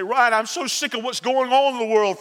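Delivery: ordinary talk, nothing marked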